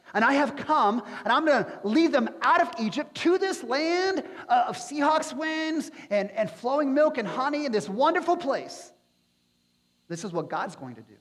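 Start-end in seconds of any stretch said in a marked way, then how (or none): 0.1-8.9 God grandly announcing his big plan; triumphant, rising proclamation; excited God. Acted. God voiced announcing rescue plan.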